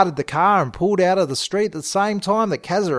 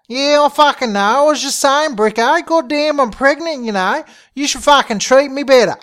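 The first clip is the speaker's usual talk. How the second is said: high-pitched, louder aggrieved rant; indignant complaint; 8 semitones higher, 5 dB louder than usual. Acted. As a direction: complain at him in a high, heated voice. Pregnant Sheila quarrels with Bricko.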